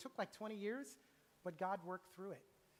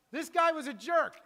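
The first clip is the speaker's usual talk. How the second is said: slow, much louder punchline; loud retold quote; 8 semitones higher, 16 dB louder than usual. Pastor retelling his brother's blunt wedding toast, slow and loud. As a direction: hit each word of the blunt toast.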